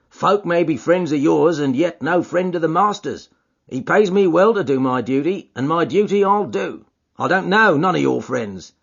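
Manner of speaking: suspicious doorkeeper voice; guard the door, wary and blunt; gruff porter voice. Acted.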